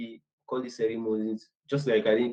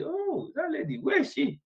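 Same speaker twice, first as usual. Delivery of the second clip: high-pitched "oh, her!" reaction; surprised recognition; 4 dB quieter than usual. Retold or imitated. Retelling a search for a new team member, high surprised recognition. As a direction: act out pleasant surprise, pitch leaping up. Retold exchange about finding a colleague.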